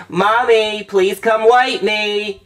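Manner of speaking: high, drawn-out kiddie plea; child calling Mommy. Acted. Mother showing Cody what to say, high sing-song child plea. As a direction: whine it slowly like a small child calling out. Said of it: "You're supposed to say".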